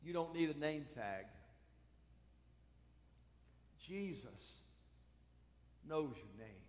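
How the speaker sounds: low and soft, slowing down; quiet, tender reassurance; hushed sermon. Acted. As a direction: drop to a near hush.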